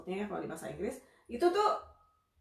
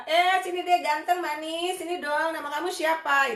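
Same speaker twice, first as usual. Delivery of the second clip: menyapa anak kecil; 8 semitones higher, 7 dB louder than usual. Acted. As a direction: coax a little child over in a raised sing-song. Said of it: "umum orang bertanya kan seperti itu". (membujuk anak dengan nada tinggi)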